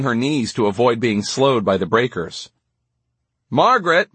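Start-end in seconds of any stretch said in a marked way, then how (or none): 3.5-4.1 high-pitched, contained narrated shout; shouted name. A narrator's reading. Gwinnett calls to Margaret as she runs, contained shout. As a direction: call after her, pitch raised. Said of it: "shouted Gwinnett".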